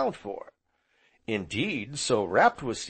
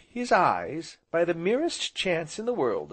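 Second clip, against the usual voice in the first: usual speaking voice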